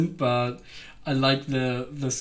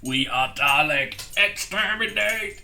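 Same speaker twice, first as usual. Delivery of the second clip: robotic monotone climbing higher and slower; Dalek; 6 semitones higher, slower than usual. Acted. Imitating a sci-fi villain who only knows one phrase, robotic and escalating. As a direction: stretch the words out, mechanically, rising to a high cry. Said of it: "Like the Dalek".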